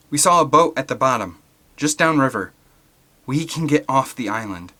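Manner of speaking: bursting with hope; excited. Acted. Eric telling the group about the boat, excited. Eric's eager news.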